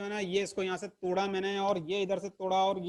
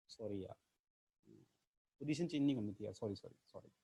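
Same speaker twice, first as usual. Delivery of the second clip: much softer, lower mock apology; sheepish quiet sorry; 9 semitones lower, 9 dB quieter than usual. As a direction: shrink away apologising to the students. Teacher apologising after scolding. (धीमी, झेंपी हुई माफ़ी)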